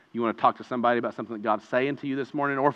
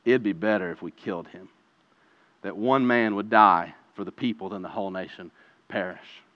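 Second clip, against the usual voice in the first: a hint of a scheming priest, lower and softer; lightly voiced high priest; 6 semitones lower, 4 dB quieter than usual. Preacher voicing the high priest's plot, lower and softer. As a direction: murmur the plot, a touch darker. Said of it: "he says".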